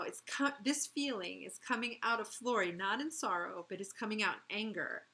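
plain reading voice

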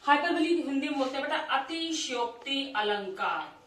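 ordinary talk, nothing marked

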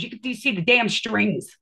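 ordinary delivery

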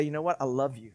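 quiet loving father; tender parent. Retold or imitated. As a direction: gently reassure the child. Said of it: "And then I would say".

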